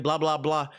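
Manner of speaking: ordinary talk, nothing marked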